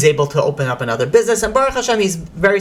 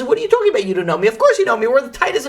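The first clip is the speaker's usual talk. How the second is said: high-pitched retold disbelief; incredulous protest; 7 semitones higher, faster than usual. Friend insisting to a denying friend, incredulous protest.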